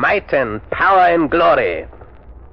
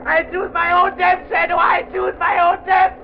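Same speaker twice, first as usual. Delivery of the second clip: put-on dying boy-villain screams his defiance; terrified boy. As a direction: shriek at the end.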